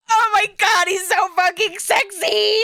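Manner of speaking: high, loud, delighted squeal; can't contain the thrill; excited gushing. Real, not acted. Streamer gushing as the game character cries, excited squeal.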